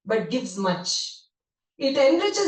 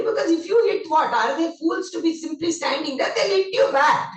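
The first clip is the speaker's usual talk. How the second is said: higher, louder rebuke; emphatic scolding; 7 semitones higher, 4 dB louder than usual. Retold or imitated. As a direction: drive the point home forcefully. Swami scolding the child.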